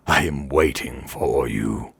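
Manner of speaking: slow, low, eerie menace; cold undead creature, low and drawn out; deep, cold voice. A narrator's reading. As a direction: intone it slowly from beneath the ground. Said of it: "deep and cold".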